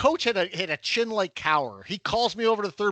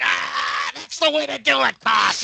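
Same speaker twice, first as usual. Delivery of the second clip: loud, higher cheering praise ending in a shout; proud coach; 6 semitones higher, 5 dB louder than usual. Retold or imitated. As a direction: congratulate the home-run hitter at third. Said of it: "He's like".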